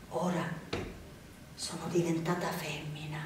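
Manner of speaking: low, very soft, near whisper; fearful hush. A narrator's reading. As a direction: keep it secret, barely voiced, with long pauses. Narrated girl frightened, hiding from man.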